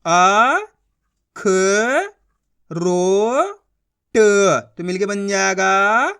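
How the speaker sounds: slow, spaced-out syllables, then the whole word stretched; teacher modelling blending syllables into a word; syllable-by-syllable reading drill. Shown as a demonstration. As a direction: sound out each syllable for children, then blend. Teacher repeats the blending demo.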